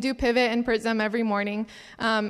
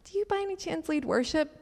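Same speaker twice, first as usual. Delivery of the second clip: higher, softer, tentative asking; hesitant question; 6 semitones higher, 5 dB quieter than usual. Retold or imitated. Asking an unknown girl at worship, hesitantly. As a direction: politely feel out a stranger.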